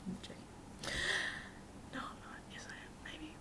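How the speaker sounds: very soft teasing back and forth; soft playful asides; teasing whisper. Real, not acted. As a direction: whisper it like a private joke. Teasing asides about the housemate.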